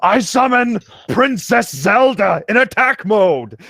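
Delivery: high, slow, loud battle call; mock summoning cry. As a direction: declare a grand summoning, laughing.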